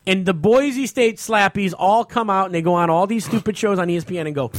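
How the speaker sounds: ordinary talk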